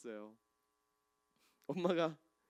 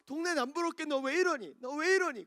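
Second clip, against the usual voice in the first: higher, sharp mother's scolding; mother shrieking at her messy son; 10 semitones higher, 5 dB louder than usual. Retold or imitated. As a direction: scold him like an exasperated mom.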